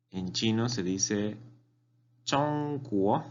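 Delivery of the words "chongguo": "chongguo" held high and level, then rising; careful tone demo of "chongguo"; first and rising tones. Shown as a demonstration. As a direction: sustain "chong" high, lift "guo" upward. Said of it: "Primer tono".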